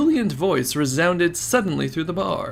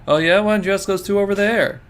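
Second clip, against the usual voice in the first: higher and much louder, daring; loud taunting challenge; 7 semitones higher, 7 dB louder than usual. Acted. Reporter pointing out the two thieves, loud taunting. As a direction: call him out across the bar. Minorikawa calling out gang.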